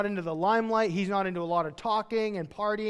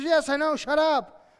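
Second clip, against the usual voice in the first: high, loud mock exasperation; exasperated retort; 9 semitones higher, 7 dB louder, slower than usual. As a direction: shut them down, loudly fed up. Acts out Elisha's exasperated answer.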